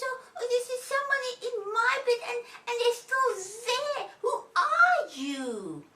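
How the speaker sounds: high, loud, wailing little voice; Baby Bear. A narrator's reading. Baby finds someone in the bed, high upset wail. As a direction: stammer in alarm, then stretch out the question. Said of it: "And Baby says".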